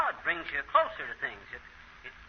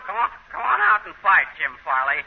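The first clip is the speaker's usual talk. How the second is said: put-on drunk challenge; 4 dB louder than usual. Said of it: "He was saying".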